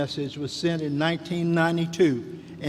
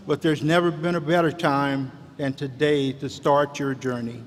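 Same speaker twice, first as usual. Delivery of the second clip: ordinary talk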